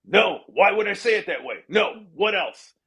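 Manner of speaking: abrupt. Retold or imitated. Trump turning down the joke writer's suggestion, abrupt. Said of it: "I'm making it so".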